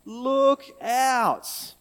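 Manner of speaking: loud, high call of look out; yelled warning. Shown as a demonstration. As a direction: shout it to stop someone at a cliff edge. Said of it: "Grown-ups, can you say that?".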